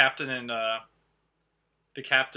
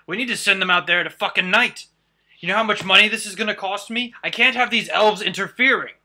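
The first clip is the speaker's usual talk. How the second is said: much higher and louder furious rant; spitting angry dwarf; 9 semitones higher, 13 dB louder than usual. Acted. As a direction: spit the words at them, outraged about the cost. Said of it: "he spouts, he spits".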